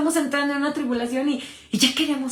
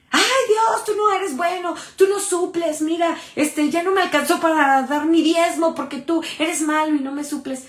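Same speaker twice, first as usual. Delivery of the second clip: mock grumbling at God; 3 semitones higher than usual. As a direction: pout and whine at God about money. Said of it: "¿Renegamos?".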